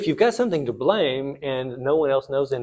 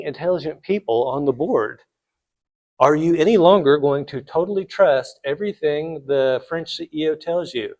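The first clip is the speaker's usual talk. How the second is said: normal talking voice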